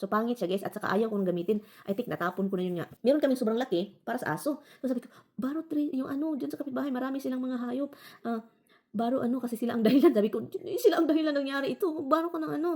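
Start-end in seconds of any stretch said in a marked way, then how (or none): 5.4-12.7 high, pressing instructions; insist, a little frantic; insistent urging. Retold or imitated. Urging her husband to go ask the neighbors, insistent.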